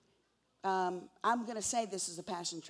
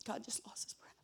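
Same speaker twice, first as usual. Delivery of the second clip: whisper; 2 semitones higher, 10 dB quieter than usual. Acted. Preacher repeats line in a whisper.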